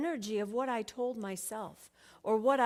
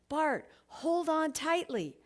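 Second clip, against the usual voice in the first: restrained call, higher and drawn out; mild yell; 6 semitones higher, slower than usual. A narrator's reading. Dad calling to child in a tree over the wind, mildly yelled. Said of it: "my dad's voice over the wind yelling".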